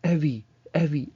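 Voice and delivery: high calling yell. Retold or imitated. Dad calling his daughter upstairs, a loud high yell. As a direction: call her name from another floor. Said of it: "not just be like".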